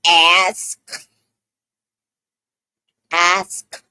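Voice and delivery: "Ask" alone, with a crisp final K; over-enunciated "Ask"; careful pronunciation. Shown as a demonstration. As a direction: say "Ask" slowly, releasing the "sk". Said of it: "I said ask".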